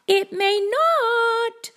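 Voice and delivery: put-on high, drawn-out little-girl wail; high, slow child's lament; dismayed Sally. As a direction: stretch it out high and worried. Sally frets in story.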